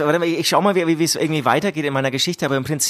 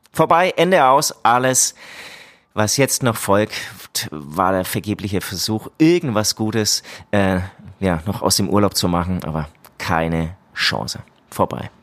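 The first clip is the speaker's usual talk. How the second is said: dramatic reading; 7 semitones lower, slower than usual. Acted. Reads his travel story aloud.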